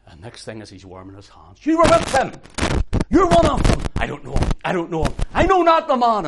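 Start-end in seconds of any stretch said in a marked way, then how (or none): 1.7-3.8 high, very loud accusation; accusing shout. Retold or imitated. Bystanders accusing Peter by the fire, loud accusing shout. 4.0-6.3 loud denial climbing to a high yell; shouted denial. Retold or imitated. Peter denying that he knows Jesus, rising shout.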